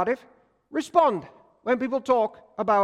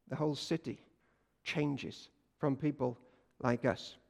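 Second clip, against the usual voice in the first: hushed awe; 6 semitones lower, 8 dB quieter than usual. Acted. Hushed retelling of the city changing.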